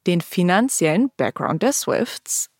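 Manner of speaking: ordinary delivery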